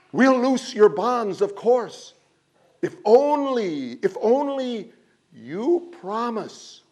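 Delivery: high, loud desperate begging; pleading crowd. A narrator's reading. Villagers plead with the wind for a promise, high desperate begging. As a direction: beg and bargain anxiously. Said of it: "began to plead and bargain".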